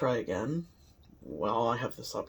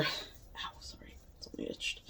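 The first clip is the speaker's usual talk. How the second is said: whispering; 12 dB quieter than usual. Real, not acted. Itching nose before a sneeze.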